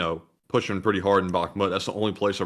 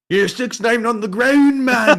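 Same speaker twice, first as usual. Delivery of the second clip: high, loud, laughing meme-guy voice; 14 semitones higher, 8 dB louder than usual. Retold or imitated. Co-host quotes the meme to his partner in a high laughing voice. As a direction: squeak it out like the meme, cracking up. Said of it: "that meme with that guy walking".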